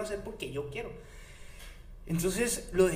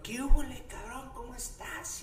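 saludo efusivo imitado; 8 semitones higher than usual. Retold or imitated.

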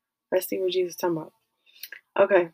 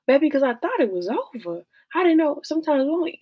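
breathless high aside; 8 semitones higher, 4 dB louder than usual. Real, not acted. Emotional aside after outcry.

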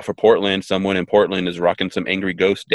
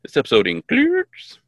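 higher-pitched mock modulated robot; robotic glitch imitation. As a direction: imitate a voice warping on "includes". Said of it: "kind of modulated a little bit in the includes".

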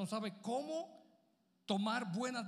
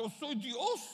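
lightly voiced Jesus, a touch higher; a hint of Jesus speaking as God's Son; playing Jesus; 4 semitones higher, slower than usual. Pastor voices Jesus protesting to the congregation, lightly raised. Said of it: "Pero si tú fueses Jesús".

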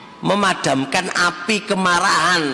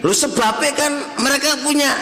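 mock blaming voice; 8 semitones higher than usual. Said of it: "Anda jangan lihat gini".

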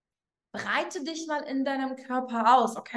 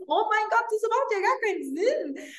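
higher, amused laugh through the words; 8 semitones higher than usual. Acted. Listener laughing at their own doubts, higher with a laugh. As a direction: laugh at how absurd it is. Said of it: "Und du lachst darüber".

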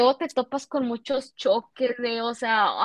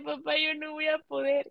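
higher-pitched, tearful plea to dad; retold whimpering protest, pitched up; mock crying; 4 semitones higher, 4 dB quieter than usual. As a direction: imitate crying that you can't cope. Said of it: "le decía a mi papá llorando".

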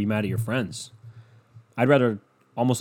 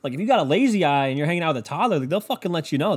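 usual speaking voice

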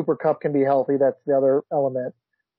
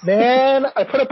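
exasperated outburst; 9 semitones higher, 5 dB louder than usual. Real, not acted. Frustrated at bad score.

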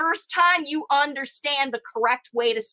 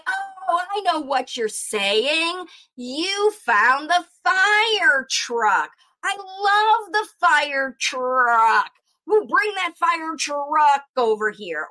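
excited recast; 3 semitones higher, much slower than usual. Shown as a demonstration. Parent modelling the word to a late talker, slow and delighted. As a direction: gush over the fire truck to the child. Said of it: "you say".

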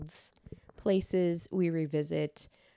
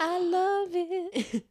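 slow, squeaky high delight; 13 semitones higher, much slower than usual. Pretend. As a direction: stretch it out in a tiny high voice. Playful reaction to episode title.

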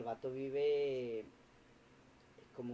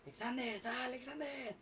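imitated calling out; 11 semitones higher than usual. Pushy date calling him out at night, mock shouting. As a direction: mimic someone shouting a name from the street. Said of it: "empezó a gritar".

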